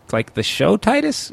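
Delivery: high, slow mock surprise; a lightly voiced surprised dad; dad's surprised question. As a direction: cashier asks, dad repeats it, high. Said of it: "And he goes".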